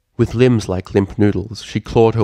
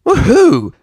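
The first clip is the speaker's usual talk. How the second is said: very high, loud cheer; filly cheering after a crash; excited whoop; 10 dB louder than usual. Acted. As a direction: whoop with thrill. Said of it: "Scootaloo pumped her hoof into the air".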